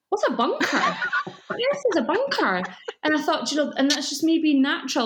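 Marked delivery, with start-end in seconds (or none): 0.1-2.6 high, baffled disbelief; bewildered high-pitched asking; baffled question. Retold or imitated. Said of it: "people said to me".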